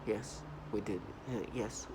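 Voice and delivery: soft, low mock-analytical muttering. Mimicking perps who act like they're analyzing, in a mock-logical murmur. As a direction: lightly imitate someone piecing it together. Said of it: "analyzing this".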